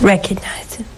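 voiceless, intimate whisper. Acted. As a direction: breathe the command out with no voice. Urging viewers after long pause.

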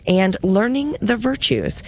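ordinary talk

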